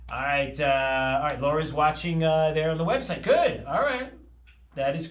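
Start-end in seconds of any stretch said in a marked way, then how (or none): 3.2-4.1 excited. Real, not acted. Host reacting excitedly to viewer.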